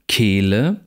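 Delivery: "Kehle" with a long close e; long tense vowel. Shown as a demonstration. Lecturer reads minimal pairs, long tense vowel. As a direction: hold the first e in "Kehle".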